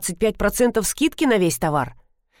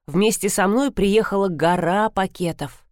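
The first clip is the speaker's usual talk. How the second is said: ordinary delivery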